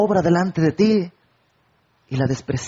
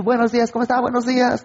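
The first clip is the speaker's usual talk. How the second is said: cheery greeting; 7 semitones higher, faster than usual. Acted. Acted friendly greeting.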